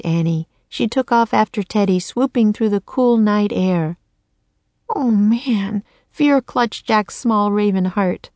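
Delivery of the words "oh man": fearful whisper. Acted. Fear clutched Jack.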